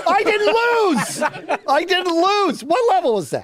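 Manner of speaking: loud, high, laughing protest; indignant shout. Real, not acted. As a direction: yell it in disbelief, laughing. Protesting after being shocked.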